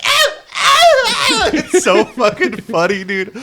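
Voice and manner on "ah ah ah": very high, louder short screams; woman screaming. Acted. Woman attacked by the doll: high mock screams. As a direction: shriek as your face is clawed. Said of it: "she's like".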